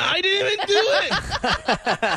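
high, drawn-out mock wail of innocence; panicked protest. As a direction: plead innocence in a hammed-up high squeal. Imagines being caught, protesting loudly.

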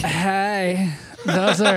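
low drawn-out moan. Pretend. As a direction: moan the compliment suggestively. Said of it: "you don't wanna moan".

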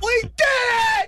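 slow high shouted cry of triumph; Rocky victory shout. Retold or imitated. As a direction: yell it out like Rocky winning.

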